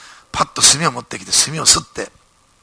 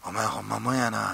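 Osaka dialect; 3 semitones lower, 6 dB quieter than usual. Retold or imitated. Company president praising the young man in Osaka dialect. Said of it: "こう大阪弁です".

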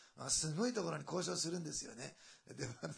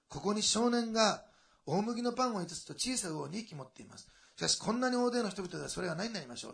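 scripture reading; 5 semitones higher, 6 dB louder than usual. Acted. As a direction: read the verse out with weight. Reads scripture aloud.

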